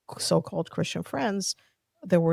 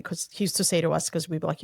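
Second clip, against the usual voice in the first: ordinary talk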